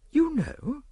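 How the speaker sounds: high, startled gasp of a question; stunned, high-pitched query. A narrator's reading. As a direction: gasp it in shocked disbelief. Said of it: "she gasped".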